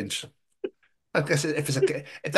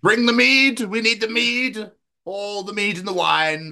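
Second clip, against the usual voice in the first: hammed-up lord; 7 semitones higher than usual. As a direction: call for the mead like a lord of old. Mock lord calling for mead.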